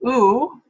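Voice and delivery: ooh held as a pure vowel; ooh vowel demo. Shown as a demonstration. Lecturer demonstrating the ooh vowel. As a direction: hold a clean ooh. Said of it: "a sound like".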